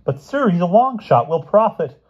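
high, loud schoolboy plea; eager boy protesting; pleading protest. A narrator's reading.

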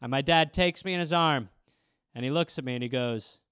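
usual speaking voice

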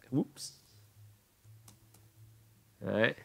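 ordinary talk

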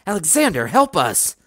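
high, desperate appeal; high-pitched urgent plea; pleading. A narrator's reading. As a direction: call out begging for help. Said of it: "pleaded Doctor D".

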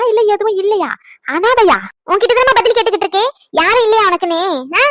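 high, loud, jeering questions; scornful taunting. Acted. Relative taunting the bride for having nobody, scornful. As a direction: press the insult home.

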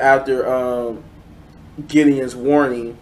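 ordinary talk

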